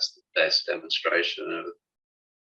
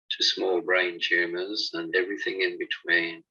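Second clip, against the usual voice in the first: ordinary talk, nothing marked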